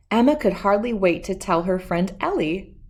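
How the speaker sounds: ordinary delivery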